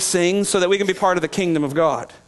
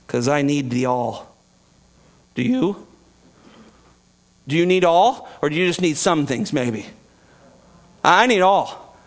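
ordinary talk

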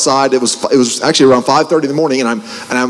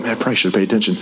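rueful mutter; 5 semitones lower, 5 dB quieter, much faster than usual. Retold or imitated. Rueful aside to self.

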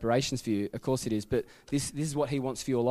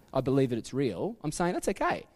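ordinary delivery

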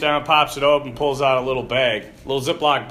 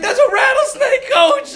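high, laughing near-cry; much higher, louder laughing wail; laughing panic; 6 dB louder than usual. Retold or imitated. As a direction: squeal it out half laughing in fright. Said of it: "They're like".

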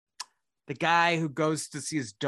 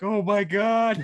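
mock sob; 7 semitones higher, 7 dB louder, slower than usual. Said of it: "I was like".

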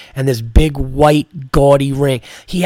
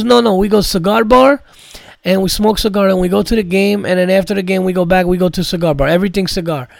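Armenian accent impression; 4 semitones higher, faster than usual. Comic imitating Hamo describing his Super Bowl plans in an Armenian accent. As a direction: boast about cigar life in a thick Armenian accent. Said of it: "imitating this guy".

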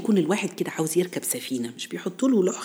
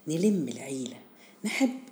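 ordinary delivery

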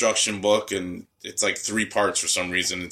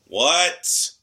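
higher, calling through the door; mock calling out; 8 semitones higher, 4 dB louder than usual. Grandma calling from outside during nap, mock call. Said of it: "And then you just hear".

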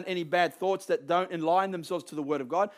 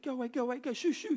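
soft, higher-pitched shooing away; half-hearted shooing; 5 semitones higher, 7 dB quieter, faster than usual. Retold or imitated. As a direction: shoo a dog without any force. Mimes shooing a following dog.

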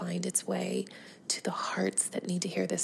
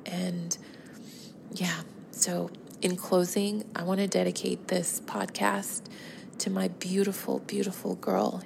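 ordinary talk

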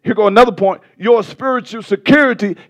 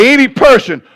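raised, emphatic pulpit proclamation; impassioned preaching; 4 semitones higher, 3 dB louder than usual. Real, not acted. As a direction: drive it home to the congregation. Preacher builds against false religions.

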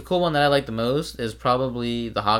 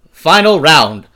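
booming, higher announcer call; fighting game announcer; 4 semitones higher, 13 dB louder, much slower than usual. Acted. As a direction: bellow it like an arcade announcer. Announcing the final segment.

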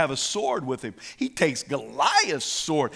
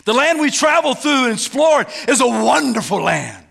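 loud, raised declaration, easing toward the end; Joshua and Caleb; 6 semitones higher, 7 dB louder than usual. Acted. Two scouts praising the promised land, bold proclamation. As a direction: proclaim it boldly, then settle. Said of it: "Listen to Joshua and Caleb's talk".

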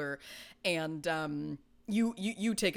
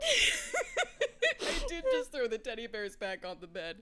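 laughing; 5 semitones higher, slower than usual. Real, not acted. Explaining while laughing.

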